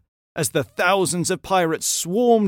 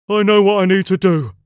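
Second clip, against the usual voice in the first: loud, raised booming giant voice; giant pirate; 6 semitones higher, 8 dB louder than usual. A narrator's reading. Giant George volunteers to lighten the ship, loud giant voice. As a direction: announce it big and loud. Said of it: "said Gigantic George".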